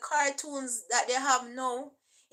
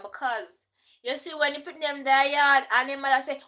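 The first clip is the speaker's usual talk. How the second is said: usual speaking voice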